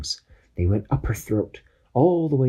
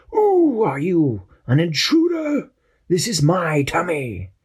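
slow, high and loud indignant germ; germ's voice; 8 semitones higher, 3 dB louder, slower than usual. Acted. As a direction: demand it like an outraged little monster. Germ confronts intruder.

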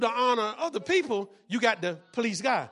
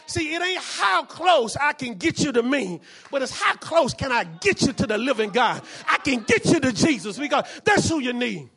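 preaching; 5 dB louder than usual. Acted. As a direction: drive each word home to the church. Preaching loud, slow build.

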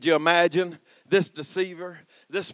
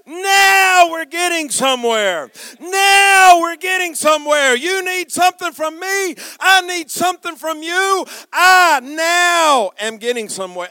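triumphant shout; 10 semitones higher, 9 dB louder than usual. Acted. God answering Jacob's surrender, triumphant shout. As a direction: proclaim the breakthrough at full force.

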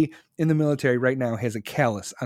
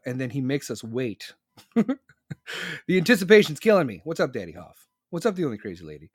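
ordinary talk

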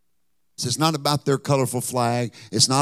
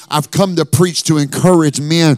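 building to a loud, stretched-out climax; rousing pulpit exhortation, high and loud by the end; full-force preaching; 5 semitones higher, 11 dB louder than usual. Real, not acted. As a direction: drive each phrase home like a revival preacher. Preaches to encourage men.

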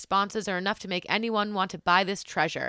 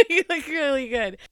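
laughing through the words; 7 semitones higher, 7 dB louder than usual. Real, not acted. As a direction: say it mid-laugh, delighted. Laughing compliment on camera look.

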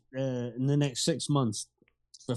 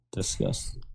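breathy whispered disgust, lower; 6 semitones lower, 6 dB quieter than usual. Acted. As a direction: whisper "Disgusting." low and unvoiced.

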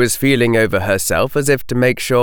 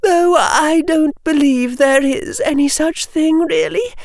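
put-on high girlish voice, mildly downcast; a little sad. Hannah doubting double witches exist, a little sad. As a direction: let a touch of disappointment in. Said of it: "sounding a little sadder".

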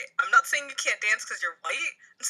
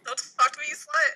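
high, raised-voice brush-off, rising at the end; acted snub; 6 semitones higher, 3 dB louder, faster than usual. Friend reading Jimmy a catchphrase line, acted snub. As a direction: snap it out higher and louder, as a line read.

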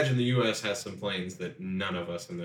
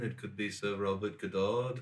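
jokey attempt at Liverpudlian; put-on Scouse, playing with the name; Liverpudlian accent; 5 dB quieter than usual. Shown as a demonstration. Showing friends another British option, mock Liverpudlian accent. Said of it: "do it more Liverpudlian".

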